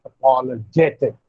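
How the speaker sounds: "Apologetic" with the weight on "ge"; stress on JE. Shown as a demonstration. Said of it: "the stress will be on".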